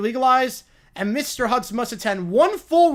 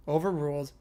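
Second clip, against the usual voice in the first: normal talking voice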